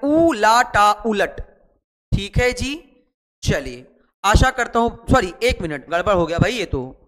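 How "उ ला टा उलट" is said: each syllable drawn out slowly; teacher sounds out the word syllable by syllable; slow spelling out. Shown as a demonstration. Teacher dictating to pupils, slow and spaced. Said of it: "हल्का dash पहले लगा दे".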